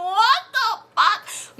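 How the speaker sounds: high, slow laughing cry of disbelief. Real, not acted. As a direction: relive the shock through laughter. Sister punches the lady.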